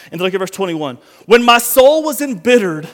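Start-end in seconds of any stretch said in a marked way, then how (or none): 1.3-2.9 loud scripture reading. Acted. Reading psalm with force.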